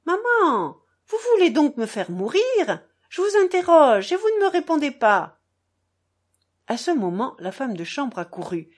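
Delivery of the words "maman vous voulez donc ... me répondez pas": upset demand. Acted. Lise demands, upset, from behind door.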